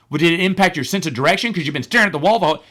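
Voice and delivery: buddy gleefully springing a joke; needling question. Retold or imitated. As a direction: fire off a teasing challenge in a rush.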